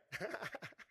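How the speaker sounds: a short imitated chuckle of disbelief; mock laugh. Preacher imitating the old couple laughing at God's promise. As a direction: laugh like Abraham and Sarah at the news. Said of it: "they're like".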